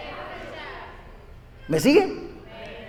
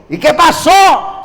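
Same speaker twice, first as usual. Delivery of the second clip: slow shouted challenge; 6 semitones higher, 4 dB louder, slower than usual. Acted. Devil challenges the angel.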